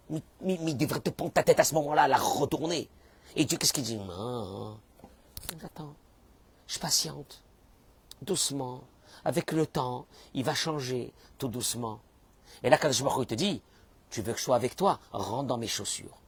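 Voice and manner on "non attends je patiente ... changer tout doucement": patient calm God. Acted.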